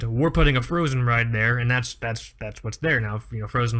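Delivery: ordinary talk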